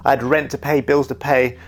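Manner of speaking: ordinary delivery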